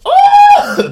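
high, loud scream of panic; mock crowd shriek at full volume; frightened scream. Retelling how everybody panics at the attack, frightened scream. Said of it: "everybody's afraid at this point".

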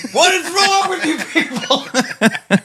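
high, slow, laughing exasperation; incredulous laughing question; laughing disbelief. Retold or imitated. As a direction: cry it out half laughing in disbelief. Retelling his outburst at bar owner.

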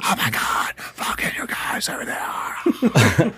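whispered crowd awe; awed whisper. Retold or imitated. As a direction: whisper it like a starstruck fan. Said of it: "entire crowd saying in unison".

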